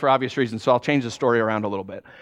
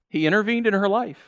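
usual speaking voice

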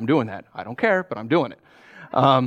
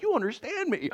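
touched wife; 12 semitones higher, 8 dB quieter than usual. Retold or imitated. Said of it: "she's like".

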